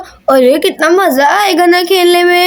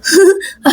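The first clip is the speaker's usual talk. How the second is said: quick rising giggle; short laugh. Acted. Chintu laughs at the ghost makeup game, short giggle.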